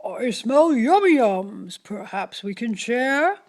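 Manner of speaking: loud, eager whispered badger voice; a sly, greedy badger sniffing treats; grinning badger. Badger sniffs at the air and asks to share. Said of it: "Badger says with a grin".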